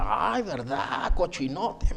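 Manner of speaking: long drawn-out teasing "ay", imitating; mock-scandalised, stretch it out slowly; teasing boss. Boss catches employee leaving the storeroom, teasing.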